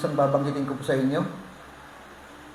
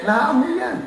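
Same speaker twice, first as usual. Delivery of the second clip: laughing; 10 semitones higher, 5 dB louder, much slower than usual. Real, not acted. Laughing at own joke.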